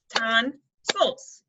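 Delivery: "tonsils" split, TON, pause, SILS; broken into syllables. Shown as a demonstration. Teacher correcting a guess of five syllables, "tonsils" broken in two. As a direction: say "tonsils" as two separate beats. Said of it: "kinda have, break up that pattern".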